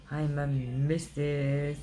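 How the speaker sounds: "I'm a missus" stretched out slowly and savoured; proud drawn-out boast. Real, not acted. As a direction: gloat over each word slowly. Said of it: "Look, I'm like".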